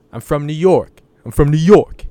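brash New York accent, hammed up. Imitating New Yorkers met while traveling, loud New York accent. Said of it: "do the accent".

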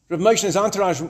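plain reading voice

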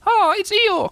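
very high, loud squeal; terribly excited cry. A narrator's reading. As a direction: squeal it out as thrilled little Roo. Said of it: "cried Roo, terribly excited".

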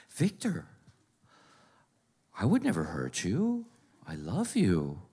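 creepy soft horror-movie mom. Retold or imitated. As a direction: say it like an eerie horror movie whisper-sweet. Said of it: "Like, creepy, like in a horror movie".

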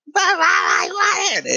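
put-on very high, slow, bawling child; temper tantrum. Child refused a toy, throwing a temper tantrum. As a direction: imitate a kid's screeching meltdown. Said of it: "And fucking temper tantrum".

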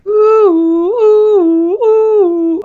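high wailing siren whoops; siren imitation. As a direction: wail it out loud like a police siren. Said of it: "there's some sirens from the P C police".

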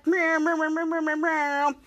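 droning mouth-made horn blasts; imitated air horns. Horns as Krampus walks out, imitated blaring. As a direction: imitate air horns in a sustained rhythmic drone. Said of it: "with air horns".

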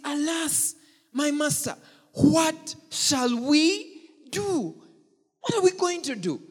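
high, drawn-out panicked cry; panicked servant. Acted. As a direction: plead like a terrified servant. Panicked servant voiced in story.

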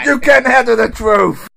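loud, high mock outburst; hammed-up movie shout. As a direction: shout it back like a courtroom showdown. Shouted film-line comeback in game.

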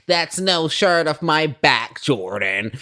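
mock-haughty brush-off, slow and loud; imitating Jackie. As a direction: shrug him off as the imagined Jackie. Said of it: "I'm sure she would say to me".

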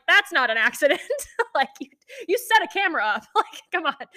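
high, exasperated retort through laughter; laughing incredulity. Retold or imitated. Woman retelling retort to boyfriend's excuse, laughing disbelief. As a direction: protest in disbelief, laughing between phrases.

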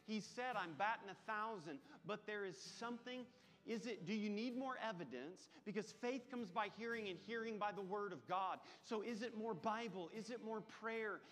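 high, loud impassioned preaching; rally the church with fervour; preaching at full force. Acted. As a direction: pastor pressing the congregation with questions.

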